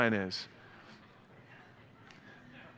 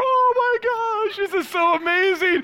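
very high, loud squeal of delight; over-the-top thrilled kid voice; ecstatic kids; 5 dB louder than usual. Retold or imitated. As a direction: imitate overjoyed children, drawing out the first words. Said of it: "And the kids are".

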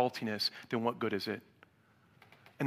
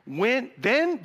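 ordinary delivery

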